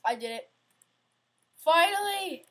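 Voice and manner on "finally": loud, high burst of relief; real relieved cheer; triumphant cheer. Real, not acted. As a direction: shout it as you finally win. Beats the hard stage at last.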